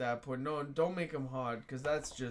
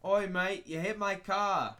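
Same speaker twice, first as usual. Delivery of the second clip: higher, louder mock Australian; Australian accent; 4 semitones higher, 4 dB louder than usual. Shown as a demonstration. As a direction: show off an Australian accent with gusto. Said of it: "Now listen to how it should be".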